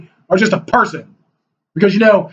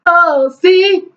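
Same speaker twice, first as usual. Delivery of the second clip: mock whine; 7 semitones higher, much slower than usual. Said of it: "you're like".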